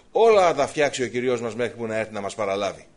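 loud, assured proclamation; emphatic preaching. Real, not acted. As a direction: proclaim it to the whole congregation. Preaching at full force. (δυνατό, εμφατικό κήρυγμα)

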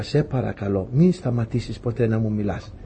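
softly, a prayer said inside oneself; barely voiced plea not to stop; hushed inner prayer. Preacher retells his silent prayer in the dream, hushed. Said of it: "έκανα μια προσευχή από μέσα μου".

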